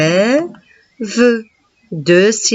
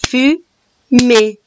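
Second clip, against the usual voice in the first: fu-mée in two beats, higher and punched; syllable split; 7 semitones higher, 3 dB louder than usual. Shown as a demonstration. Teacher splitting fumée for the pupil, punched. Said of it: "fais le découpage syllabique".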